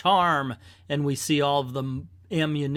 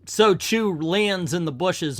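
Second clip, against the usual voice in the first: ordinary delivery, nothing marked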